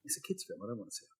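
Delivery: very soft, grudging retort; muttered refusal. Retold or imitated. Refusing his friend's film recommendation, muttered. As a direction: mutter it under your breath.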